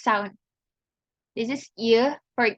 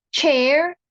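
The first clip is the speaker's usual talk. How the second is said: ordinary talk, nothing marked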